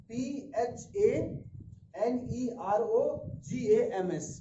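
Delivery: slow, letter-by-letter dictation; spelling out. Shown as a demonstration. Teacher spells a new term for students, slow spelling out. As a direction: spell it slowly, each letter separate.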